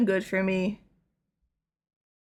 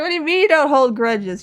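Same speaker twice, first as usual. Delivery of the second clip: incredulous; 6 semitones higher, 6 dB louder than usual. Retold or imitated. Incredulous retold argument.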